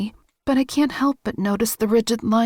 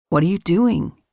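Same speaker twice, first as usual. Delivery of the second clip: deep male voice; 4 semitones lower, 3 dB louder than usual. A narrator's reading. Male character voiced by narrator.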